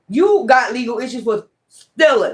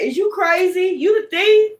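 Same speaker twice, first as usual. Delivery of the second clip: outraged disbelief; 7 semitones higher than usual. Real, not acted. Reader scoffing at accusers who call her a thief, outraged disbelief. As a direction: sound floored that they'd call you the thief.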